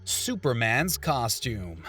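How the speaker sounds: slow, low item title; top ten countdown. Acted. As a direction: announce the next entry slowly.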